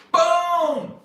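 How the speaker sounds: high, loud impact exclamation; explosive boom. Retold or imitated. Acting out a dude's response, explosive shout. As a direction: shout it like a sudden blow. Imitated punch in acted scene.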